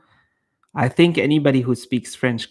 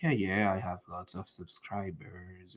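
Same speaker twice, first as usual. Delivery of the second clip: low, quiet mock brush-off; dismissive YouTuber; 6 semitones lower, 14 dB quieter than usual. Big YouTuber ignoring a smaller creator, dismissive. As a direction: imitate a big YouTuber waving people away. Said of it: "ignoring and just like".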